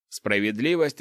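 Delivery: ordinary delivery, nothing marked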